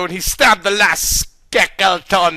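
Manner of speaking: ordinary delivery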